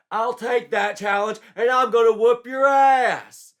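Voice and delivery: high, loud shouted boast; brash challenge. A narrator's reading. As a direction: roar back at the challenger. Joshua accepts the challenge loudly.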